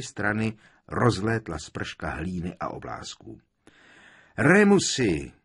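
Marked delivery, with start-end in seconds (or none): 4.4-5.3 loud, high call of a name; crying out. A narrator's reading. Tonksová rushing to Lupin off her broom, crying out. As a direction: call out to him, pitch swooping down. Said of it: "vykřikla".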